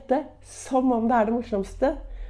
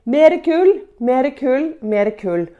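a loud high yell that fades down over the three calls; captain yelling; 3 semitones higher, 5 dB louder, slower than usual. Retold or imitated. Captain shouts to the stoker below for more coal, loud then fading. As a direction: bellow it down to the engine room, then let it die away. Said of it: "Jo, han roper".

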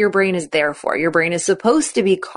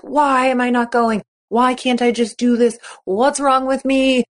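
self-judging athlete; 5 semitones higher than usual. Pretend. Voicing self-judgment.

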